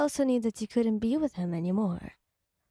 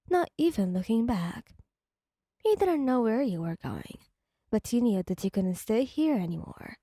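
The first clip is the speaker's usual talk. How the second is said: ordinary delivery, nothing marked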